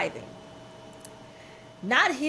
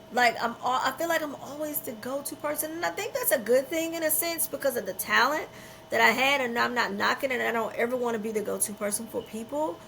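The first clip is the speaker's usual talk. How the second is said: ordinary talk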